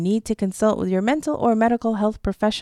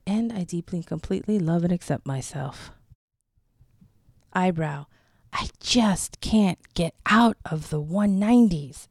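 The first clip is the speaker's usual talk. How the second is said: guided tapping script; 2 semitones lower than usual. Acted. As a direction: gently lead the listener through each point, leaving space. Leads EFT tapping script.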